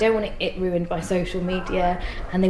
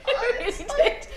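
laughing it out, high; 4 dB quieter than usual. Real, not acted. Joking about her son's birth while laughing. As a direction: giggle through the words.

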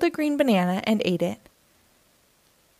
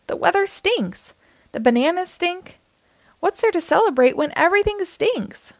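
snapped; 8 semitones higher than usual. Acted. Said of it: "snapped Jim".

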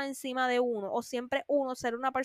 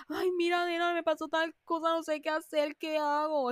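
higher, pleading imitation of a friend; lightly mock the friend who always calls for help; whiny needy friend; 4 semitones higher than usual. Mocking the friend who calls daily, whiny plea.

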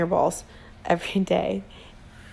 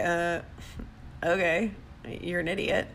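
soft, flat shrug off; unbothered dismissal; 3 semitones lower, 8 dB quieter than usual. Retold or imitated. Answering someone who tears you down, unbothered soft dismissal. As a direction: brush the insult away calmly. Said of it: "look at them and say".

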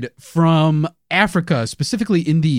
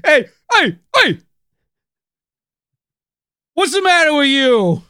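high, louder mock New York shout; New York yell; 9 semitones higher, 4 dB louder than usual. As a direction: shout back like a Brooklyn guy in traffic.

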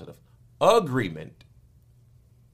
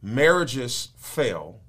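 slow emphasis; 2 semitones higher, 5 dB louder, much slower than usual. Real, not acted. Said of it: "slow stressed statement".

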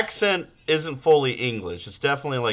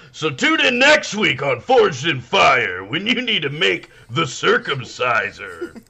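slow, booming mock show promo; hammed-up announcer, stretching out the last words; 4 semitones higher, 3 dB louder, slower than usual. Podcaster teasing next week's episode, announcer voice.

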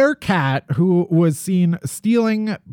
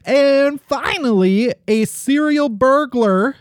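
high, slow, drawn-out headline delivery; announcer intro; 8 semitones higher than usual. Pretend. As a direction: announce the final story with mock drama. Host introduces last story.

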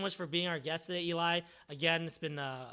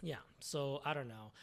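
ordinary talk, nothing marked

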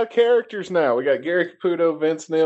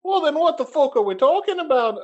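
line said in the first, off-target Canadian voice, higher; the slipped accent before the fix; wrong accent; 8 semitones higher than usual. Acted. Co-host says the guest isn't Canadian, retort in wrong accent. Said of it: "let me change that accent".

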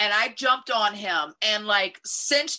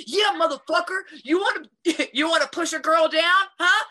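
taunting yell; 6 semitones higher than usual. Retold or imitated. Retold fight, yelling at attacker.

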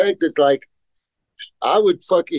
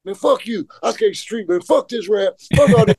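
temper tantrum; 6 semitones higher than usual. Retold or imitated. Young skater quitting the ramp in a bratty tantrum. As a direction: storm off in a sulky tantrum, pitched up. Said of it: "my temper tantrum ass".

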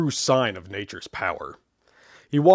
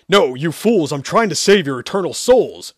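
loud, higher frantic shout; 5 semitones higher, 8 dB louder than usual. Acted. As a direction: shout to save their souls. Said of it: "Sherman shouted".

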